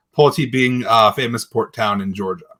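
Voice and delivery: normal talking voice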